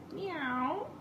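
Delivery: high rising meow; kitty meow. Pretend. Parent meowing like a kitty to the child. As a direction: mew like a small kitten.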